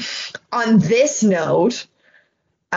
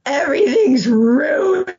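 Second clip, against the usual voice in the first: slow, higher, crying wail of despair; melodramatic wail; 7 semitones higher, 3 dB louder, slower than usual. Retold or imitated. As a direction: act out the meltdown, arms dropped, voice breaking. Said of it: "I dropped both my arms and I went".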